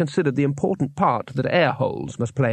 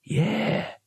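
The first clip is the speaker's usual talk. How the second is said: cautiously; 6 semitones higher, 4 dB quieter than usual. A narrator's reading. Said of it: "said the leader cautiously".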